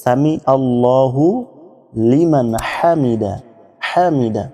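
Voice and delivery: সামি আল্লাহু, pause, Liman Hamida, slow; pause between words. Shown as a demonstration. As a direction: stop after আল্লাহু before Liman. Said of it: "এজন্য এখানে থেমে থেমে বলবেন". (আল্লাহু-র পরে থেমে বলা)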